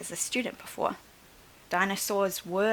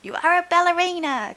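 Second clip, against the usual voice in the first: put-on high, loud children's cry; 10 semitones higher, 8 dB louder than usual. Clara and Jack insist to the dinosaur, high eager cry. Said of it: "cried Clara and Jack".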